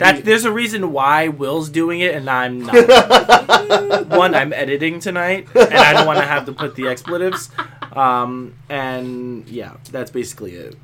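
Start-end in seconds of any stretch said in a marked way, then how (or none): 0.0-7.5 explaining through laughter, louder; laughing. Real, not acted. Co-host excuses himself from reading the swearing, laughing. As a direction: talk over a laugh.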